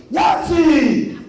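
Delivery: put-on high, loud gleeful yell of a little boy; kid's excited shout. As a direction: shout it like a thrilled four-year-old. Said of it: "and he goes".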